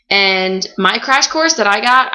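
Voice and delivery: ordinary delivery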